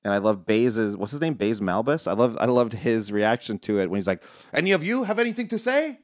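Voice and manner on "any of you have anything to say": high, hammed-up challenge to the group; mock warrior daring anyone to object; mock gruff challenge. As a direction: throw the question out like a tough guy. Quoting Baze Malbus.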